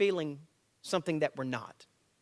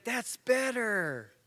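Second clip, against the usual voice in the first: approving dad; 5 semitones higher, much slower than usual. Retold or imitated. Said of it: "So I come over and I said".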